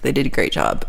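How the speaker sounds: usual speaking voice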